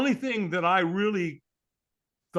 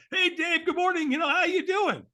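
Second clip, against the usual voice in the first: much higher, bright and bouncy hello; overly enthusiastic greeting; 13 semitones higher than usual. Retold or imitated. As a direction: play the over-eager AA guy. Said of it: "He's like".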